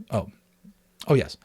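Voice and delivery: ordinary delivery, nothing marked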